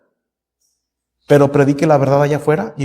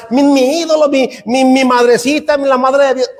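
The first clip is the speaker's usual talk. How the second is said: crowd shouting; 7 semitones higher than usual. Retold or imitated. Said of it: "empezaban a gritar".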